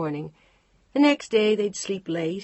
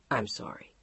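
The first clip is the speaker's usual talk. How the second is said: whispered sorry; 7 semitones lower, 6 dB quieter than usual. Acted. Tender apology in the car.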